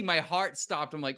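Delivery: ordinary talk, nothing marked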